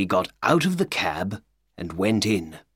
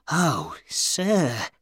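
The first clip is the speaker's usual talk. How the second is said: excited; 4 semitones higher than usual. A narrator's reading. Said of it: "Ryder said excitedly".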